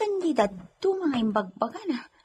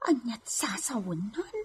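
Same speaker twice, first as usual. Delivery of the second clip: hushed whisper; 3 semitones lower, 9 dB quieter than usual. Acted. Servant whispering to himself, puzzled. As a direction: breathe it to yourself.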